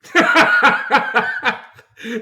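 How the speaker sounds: big burst of laughter; loud laugh. Real, not acted.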